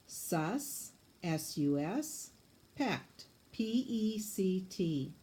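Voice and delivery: "Sus" then S U S, "pect" then P E C T, slow letters; scooping syllables. Shown as a demonstration. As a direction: split "Suspect" and spell each syllable. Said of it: "scoop it for me".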